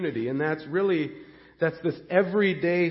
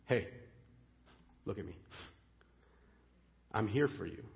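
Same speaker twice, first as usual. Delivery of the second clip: softer, hushed and gentle, close to a friend; tender reassurance; 8 semitones lower, 12 dB quieter than usual. Acted. Jesus quietly reassuring his anxious disciples, softly. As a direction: lean in and calm a frightened friend. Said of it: "Jesus says".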